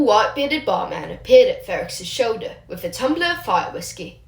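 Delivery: usual speaking voice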